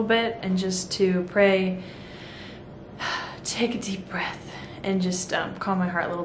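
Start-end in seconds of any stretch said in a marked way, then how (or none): 1.9-3.4 long breathy sigh out; deep sigh. Real, not acted. Pausing to calm down. 3.4-4.5 soft breathy whisper. Real, not acted. Pausing to calm down.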